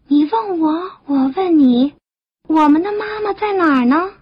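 put-on high, childlike questioning voice; tadpole children. Tadpoles asking each other for mother, high and puzzled.

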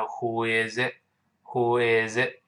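"who is it" run together, no gaps between words; linked reading. Shown as a demonstration. English teacher shows learners linking, words run slowly together. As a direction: link "who is it" into one smooth slow run. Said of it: "放在一块儿连读".

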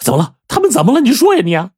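high, urgent demand for an answer; frantic urging. A narrator's reading. Father grabs mute wife about his children, frantic. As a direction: grow frantic, pleading with her to speak. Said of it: "把八狗给急得团团转".

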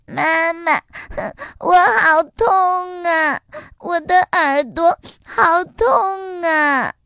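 slow, high crying wail; crying bunny. Acted. Little rabbit cries to his mother, slow and high. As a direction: sob to mummy about the sore ear. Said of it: "可怜的跳跳兔哭起来了".

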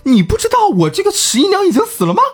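very high, fast mock-weeping wail; hammed-up tearful protest. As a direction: overplay a grieving lover's squeaky, tearful reproach. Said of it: "这孟生含泪说". (夸张地带着哭腔尖声质问)

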